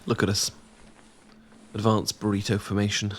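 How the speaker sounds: usual speaking voice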